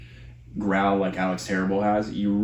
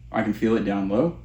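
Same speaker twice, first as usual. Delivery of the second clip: ordinary talk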